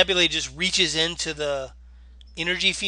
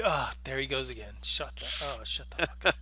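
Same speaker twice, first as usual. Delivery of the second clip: annoyed listener; 3 semitones lower, 7 dB quieter than usual. Retold or imitated. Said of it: "and I'm like".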